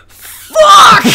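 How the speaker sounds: the cousin spots the lady, shouting; startled curse. Retold or imitated. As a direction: blurt it out in shock.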